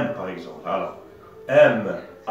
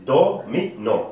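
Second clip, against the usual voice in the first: "Do", "mi", "non" each said apart, raised pitch; syllable by syllable. Shown as a demonstration. Teacher repeating the example for pupils, "Do, mi, non" split syllable by syllable. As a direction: separate the beats DO, MI, NON. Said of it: "c'est le découpage syllabique".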